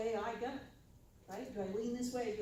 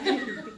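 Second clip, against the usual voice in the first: a burst of real laughter; short laugh; 10 dB louder than usual. Real, not acted. Catching herself criticizing the book: short laugh. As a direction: laugh at yourself.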